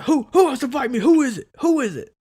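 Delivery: high, loud mock yell demanding a taker; aggressive challenge. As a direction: shout it like a hyped-up guy spoiling for a fight. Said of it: "And then he's like".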